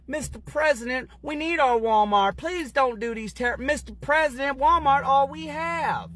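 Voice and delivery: put-on high, pleading old man's whine; imitating a tearful old fan pleading for his Walmart; mock begging old man. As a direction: beg the President like a desperate old supporter. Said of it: "the old guy in the maga hat begging".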